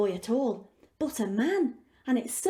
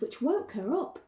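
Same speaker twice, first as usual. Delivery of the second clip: usual speaking voice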